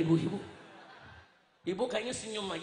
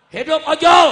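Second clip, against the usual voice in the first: high, very loud rallying slogan; shouted cheer; 7 semitones higher, 23 dB louder than usual. Real, not acted. Preacher cheering motorbike taxi drivers before the crowd, shouting. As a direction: cheer it out at full force.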